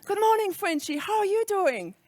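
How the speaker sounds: bright high Canadian-accented morning greeting, imitated. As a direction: mimic a cheerful Canadian boss. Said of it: "I cannot do the Canadian accent".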